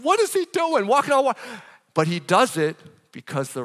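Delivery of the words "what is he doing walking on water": high-pitched retold panic; high, alarmed mock outburst; freaking out. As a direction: act out someone freaking out in disbelief. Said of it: "he's freaking out".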